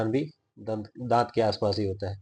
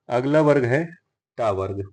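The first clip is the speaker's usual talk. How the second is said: ordinary talk, nothing marked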